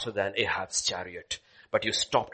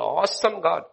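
ordinary talk, nothing marked

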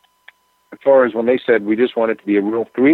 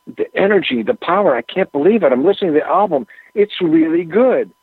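higher, eager, mildly imitating enthusiasm; retold excitement; 6 semitones higher than usual. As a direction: play back his thrilled rush of praise. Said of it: "Keith switched gears right there".

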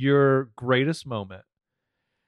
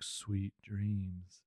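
soft goodnight; 3 semitones lower, 13 dB quieter than usual. Acted. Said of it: "and she's like".

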